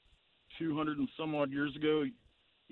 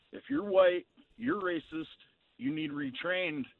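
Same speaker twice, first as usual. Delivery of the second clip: mocking quote; 7 semitones higher than usual. Caller mocks a sweeping accusation.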